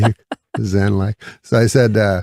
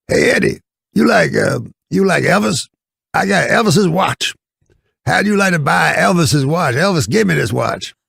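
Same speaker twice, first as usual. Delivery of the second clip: high-pitched comic old-timer impression, pushy sales pitch; imitated character voice, high and insistent; Red Fox; 10 semitones higher, 3 dB louder than usual. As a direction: hawk it in a much higher put-on voice. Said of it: "then Red Fox would say".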